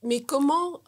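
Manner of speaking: excited outburst. Real, not acted. Excited interjection in debate.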